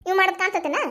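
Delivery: usual speaking voice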